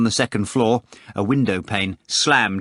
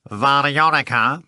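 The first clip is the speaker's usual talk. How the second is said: slow, higher nasal drawl; disgusting nasal voice; 5 semitones higher, 4 dB louder than usual. A narrator's reading. Phone caller addressing the manager, in disgusting nasal tones. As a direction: draw the name out repulsively. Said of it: "the same disgusting voice replied".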